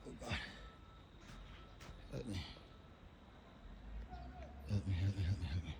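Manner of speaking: soft, sinking, desperate murmur; real quiet despair; despairing plea. Real, not acted. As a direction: let it fade into helpless pleading. Quiet despair amid wreckage.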